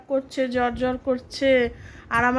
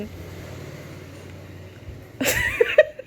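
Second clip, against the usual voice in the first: high giggle. Real, not acted. Kids playing doctor, giggle.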